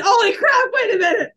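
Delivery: high, loud burst of sudden realization; real excited shout, pitched high; excited outburst. Real, not acted. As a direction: cry out as it suddenly clicks. Sudden excited realization mid-discussion.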